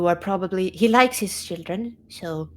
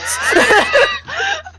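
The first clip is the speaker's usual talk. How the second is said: big laugh; 9 dB louder than usual. Real, not acted. Laughing at private dragon talk.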